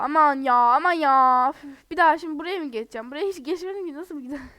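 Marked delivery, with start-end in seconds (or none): 0.0-1.5 loud annoyed groan. Real, not acted. Annoyed at having to redo.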